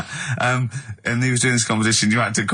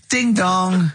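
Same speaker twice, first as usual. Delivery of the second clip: drawn-out, higher "ding dong"; imitated doorbell chime; sung-out doorbell; 5 semitones higher than usual. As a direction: play the doorbell with your voice. Doorbell in comedy sketch.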